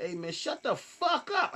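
high, slow angry retort; going off. Retold or imitated. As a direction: blow up at a heckler, stretching it out. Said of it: "I just went off".

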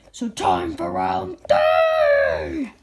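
slow booming announcer call; announcer shout. Acted. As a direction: boom it out like a game host. Puppet announcer calls next round.

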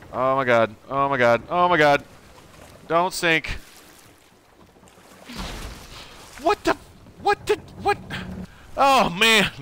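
high, loud frantic cries; rising panic. Real, not acted. Gamer panicking as his boat sinks.

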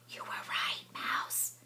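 soft unvoiced whisper. A narrator's reading. Mole whispering to Mouse near bird, whisper. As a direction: whisper so the birds stay. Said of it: "he whispered".

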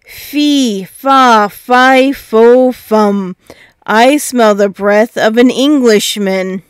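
put-on thundering giant sniffing out a boy; terrible giant voice. A narrator's reading. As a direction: bellow it out like a hungry ogre. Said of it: "he cried in a terrible voice".

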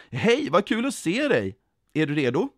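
high, lively greeting; called out gladly. A narrator's reading. Jesus ropar till den som var ledsen, glad call. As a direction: hail them with delight. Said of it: "Då stod Jesus där och ropade".

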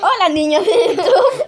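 loud slow high hammed host voice; mock youtuber greeting. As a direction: ham up a kids' show host. Mock outro greeting to viewers. (saludo exagerado de youtuber)

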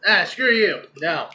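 ordinary delivery

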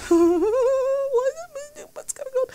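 frightened whimper. Real, not acted. High slow frightened whisper.

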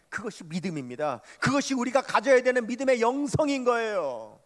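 high, loud pulpit exhortation; fiery preaching. Acted. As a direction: drive the faith message home at full force.

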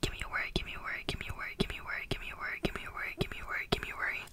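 normal talking voice

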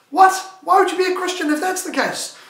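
high, loud incredulous outburst; baffled, challenging the saying out loud; confused disbelief. Acted. Preacher reacting to his old pastor's saying, baffled. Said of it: "it always kind of confused me".

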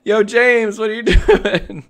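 loud, high call, with a laugh breaking in; excited call-out. Real, not acted. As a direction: shout it at him, delighted and disbelieving. Surprised by a gift of more subs.

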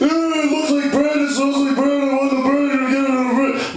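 very high, garbled squeal; hammed-up high-pitched frenzy; frantic piggy kid. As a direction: squeal like a bread-crazed piglet.

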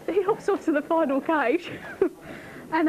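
usual speaking voice

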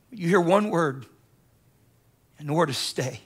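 low, softened, weighty delivery; hushed solemn word. Real, not acted. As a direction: drop low and soft for the key word. Preacher softly delivers God's one word.

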